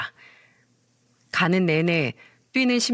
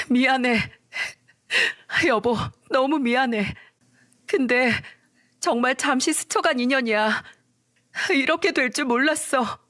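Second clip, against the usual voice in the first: higher, lightly voiced pleading wife; apologetic wife; 5 semitones higher than usual. Caught wife apologizes to husband, higher and pleading. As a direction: say sorry, lifted and pleading.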